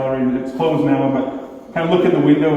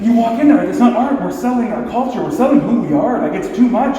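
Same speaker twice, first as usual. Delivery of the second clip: higher, louder, fired-up rant; heated, raised-voice declaration; angry outburst; 7 semitones higher, 3 dB louder than usual. Retold or imitated. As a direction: blurt it out in rising anger. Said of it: "angrier and angrier".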